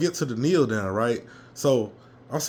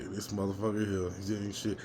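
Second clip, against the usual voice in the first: low, soft mocking mutter; muttered aside; 6 semitones lower, 11 dB quieter than usual. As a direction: mutter it under your breath with scorn. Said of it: "He was like".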